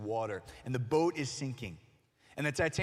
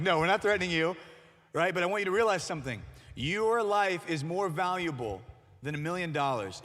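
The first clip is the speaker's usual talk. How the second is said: louder, higher emphatic reassurance; insistent YouTuber; 5 semitones higher, 7 dB louder than usual. Retold or imitated. YouTuber to the stranger, insisting loudly on the lesson. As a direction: drive the point home, loud and firm.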